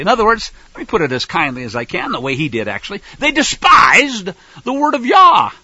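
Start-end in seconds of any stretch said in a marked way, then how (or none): 3.2-5.5 slow, loud, higher preaching cry; preached shout. Real, not acted. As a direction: hammer it home from the pulpit. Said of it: "preaching at full force".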